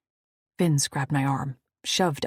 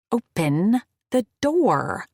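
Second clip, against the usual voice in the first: slow, insistent, louder order; firm command; 6 semitones higher, 7 dB louder, slower than usual. Acted. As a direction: spell it out with no patience. Kicking his shin, insisting.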